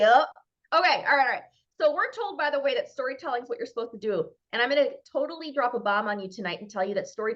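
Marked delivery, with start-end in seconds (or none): none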